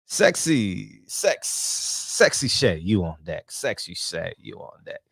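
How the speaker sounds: low, drawn-out club announcer fading away; strip club DJ. Acted. Host announcing Sexy Shay to the stage, mock DJ voice. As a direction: draw out the name, then drop low and soft. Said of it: "how come the D Js at the strip clubs, they always sound like that".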